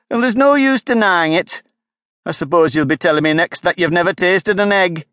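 high, loud, accusing bird voice; scornful pigeon. A narrator's reading. Pigeon accuses Alice of being a serpent, scornful. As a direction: lecture her like a suspicious pigeon.

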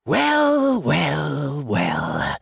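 mocking, oily greeting; sneering jackal. A narrator's reading. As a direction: sneer it slowly, sliding down the pitch. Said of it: "the jackal sneered".

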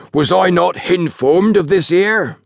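high, slow pompous outrage; awful tones. A narrator's reading. Station master demands why nobody told him, in awful tones. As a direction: draw it out with outraged dignity. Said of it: "he demanded in awful tones".

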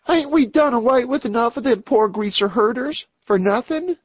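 high-pitched youngest gang member; bitter young outlaw. Acted. Youngest of the gang challenges the leader, high boyish outlaw voice. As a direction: pitch it right up as the gang's boy.

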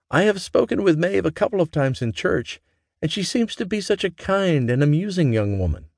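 an eager mother waiting for news; mother eager to hear daughter's news; mother's voice. A narrator's reading. Mama voiced in story.

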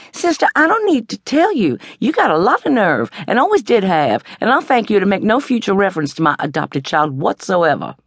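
high, pointed indignation; Stella-Rondo furiously telling off her sister. A narrator's reading. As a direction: lash out, barely holding your temper. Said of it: "Stella Rondo got furious".

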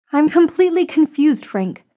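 helpless, confused sigh; sighing confusion. A narrator's reading. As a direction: lift the voice high, then drop away on the name. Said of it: "she sighed".

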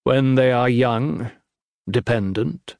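ordinary delivery, nothing marked

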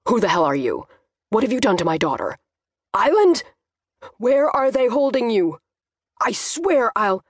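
angry, protective father losing it; exploding father. A narrator's reading. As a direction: explode at a suspected kidnapper. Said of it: "before my father exploded".

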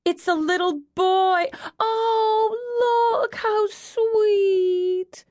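very high, stretched-out squeal; tearful cooing. A narrator's reading. Mavis with tears at the baby boy, squealing coo. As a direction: coo it, drawing out the last words tearfully.

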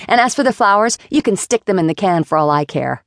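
angry rant. Acted. Flora's furious speech at Jason.

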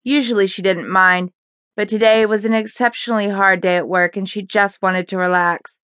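usual speaking voice

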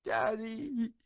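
put-on high child's voice breaking into a sob; a little girl crying for her dad; tearful, pleading "Daddy"; sobbing child. A narrator's reading. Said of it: "she sobbed".

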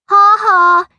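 high, loud mocking ha-ha; taunting fake laugh, pitched high; weedy jeer. A narrator's reading. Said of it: "jeered a weedy voice".

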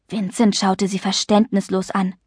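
ordinary delivery, nothing marked

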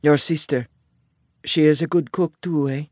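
put-on thick Italian accent, slightly lifted pitch; heavy Italian accent. A narrator's reading. Isabella asks about his sister's cooking, heavy Italian accent. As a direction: ask it as an Italian newcomer with heavily accented English. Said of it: "Isabella asked in her heavy Italian accent".

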